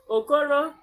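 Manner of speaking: ordinary delivery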